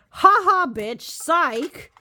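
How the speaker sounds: high, mocking ha ha of a smug company; mock taunting company. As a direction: taunt like a gloating bully. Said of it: "But U S Games said".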